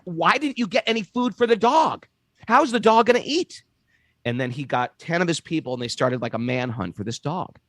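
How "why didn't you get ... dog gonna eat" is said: pitched up, anxious questions; higher, mildly alarmed retelling; worried fretting. Retold or imitated. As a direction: fret over the missing dog. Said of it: "he was incredibly upset".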